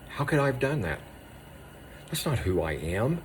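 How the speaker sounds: a hint of a tormented inner question, soft; lightly voiced self-reproach; anguished inner voice. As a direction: voice the doubt in your own head. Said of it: "it sounds something like this".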